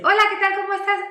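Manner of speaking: very high, loud, thin squeaky voice stuck in the head; head voice demo. Shown as a demonstration. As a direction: squeak it out way up high, disconnected from the chest. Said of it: "dar un vuelco con conciencia". (demostración de voz de pito, muy aguda y fuerte)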